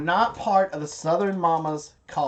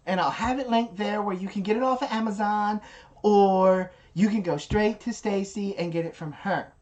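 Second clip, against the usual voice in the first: higher-pitched Southern mama voice; Amy impression; 7 semitones higher, 3 dB louder than usual. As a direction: answer the viewer the way Amy would. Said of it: "So that's my impression of Amy".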